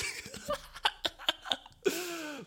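shrill laugh of despair; fed-up, giggling at the mess; high exasperated laugh. Real, not acted. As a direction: laugh at your own frustration. Laughs at lost patience.

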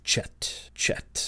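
whispered name-calling; very soft, voiceless background echo. Retold or imitated. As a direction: imitate someone off in the background, whispering. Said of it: "off in the background going".

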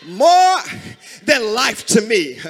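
loud, drawn-out hymn recitation; fervent preaching. Real, not acted. Preacher reciting old church hymns to congregation, fervent. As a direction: cry the hymn lines out in bursts, long pauses between.

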